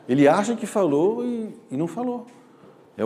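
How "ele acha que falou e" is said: normal talking voice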